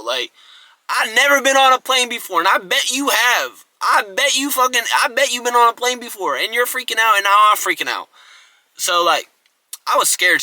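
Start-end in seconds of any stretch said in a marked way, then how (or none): 0.9-8.1 loud, high-pitched panicked rant; worked up, pitch way up, voice raised; acted freak-out. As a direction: re-enact panicking at the guy next to you. Acted freak-out at seatmate on plane.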